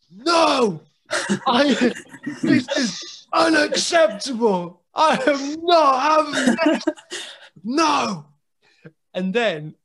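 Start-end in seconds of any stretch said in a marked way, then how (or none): 0.1-8.3 high, loud, slow shouted outrage with laughter; imitating a furious wrestler, hammed up; hammed-up tantrum. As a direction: throw a mock tantrum, laughing through it. Sam losing it, boys laughing.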